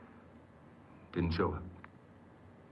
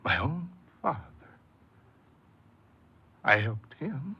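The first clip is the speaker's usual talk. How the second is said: higher, raw, wounded disbelief; hurt bewilderment; 6 semitones higher than usual. Acted. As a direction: ask it like a betrayed son. Realising father's betrayal.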